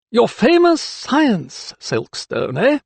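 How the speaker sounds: high, slow, then dropping; needling. A narrator's reading. Magistrate with tight smile to doctor, needling. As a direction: draw out the jibe mockingly.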